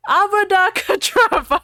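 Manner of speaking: high, loud mock curse; hammy spell shout. Ebony cursing Voldemort, hammed-up. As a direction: hurl the curse like a melodrama heroine. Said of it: "I shouted".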